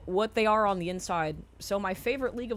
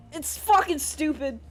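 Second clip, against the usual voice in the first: usual speaking voice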